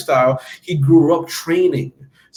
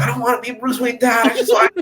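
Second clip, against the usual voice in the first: high-pitched whiny tantrum; mock bratty whine; immature brat; 9 semitones higher than usual. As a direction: protest like a bratty kid, pitched way up. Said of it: "just being an immature brat".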